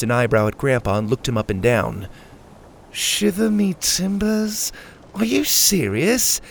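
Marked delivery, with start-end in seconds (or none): 2.9-4.7 slow, high, disbelieving question; incredulous echo. A narrator's reading. Crewmate looking Grandpa up and down, incredulous. 5.1-6.4 slow, high-pitched disbelief; incredulous. A narrator's reading. Crewmate reacting to Grandpa's pirate act, incredulous.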